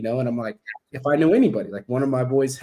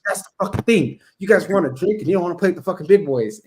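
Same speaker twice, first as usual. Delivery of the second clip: aggressive rant; 5 semitones higher, 3 dB louder than usual. Retold or imitated. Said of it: "I went on this rant".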